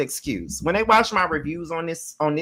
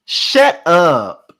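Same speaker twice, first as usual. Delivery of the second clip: loud=22; 8 dB louder than usual. Real, not acted. Repeated shut up.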